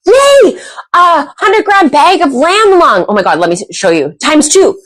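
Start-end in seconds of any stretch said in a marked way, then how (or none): 0.1-3.1 high gasp and cheer, slower prize call; excited reveal. Real, not acted. Host announcing a viewer's prize, high and thrilled. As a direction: burst out with the prize.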